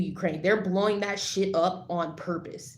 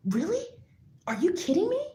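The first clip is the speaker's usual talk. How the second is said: outraged disbelief; 11 semitones higher than usual. Real, not acted.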